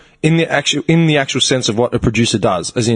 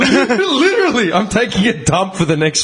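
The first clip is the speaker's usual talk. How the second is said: laughing mock excuse; 5 semitones higher than usual.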